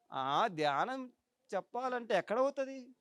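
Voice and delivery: soft, dragging mock reluctance; acted half-hearted doubter, voice dropped; doubtful reluctance. Would-be meditation teacher making excuses, doubtful.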